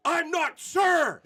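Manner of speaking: furious woman yelling her correction, high and loud; outraged shout. Retold or imitated. Angry customer shouting at the golf pro. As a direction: bellow it like she's been insulted twice. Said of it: "she was like, out of her mind".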